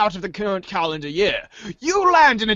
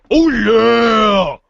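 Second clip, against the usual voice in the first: booming mascot shout, louder; Kool-Aid Man shout; 6 dB louder than usual. Pretend. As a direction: burst through the wall yelling it. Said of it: "Kool-Aid Man style".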